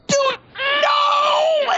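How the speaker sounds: high-pitched, drawn-out mock shout; retold horrified outcry. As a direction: reenact the team crying out in alarm. Retells teammates' horrified outcry.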